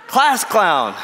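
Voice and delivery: incredulous chuckle at the slide; laughing repeat. Real, not acted. Speaker laughs at a friend's slide joke, amused. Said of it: "Wait a minute, Rich".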